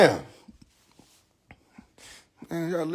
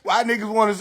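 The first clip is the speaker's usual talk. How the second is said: normal talking voice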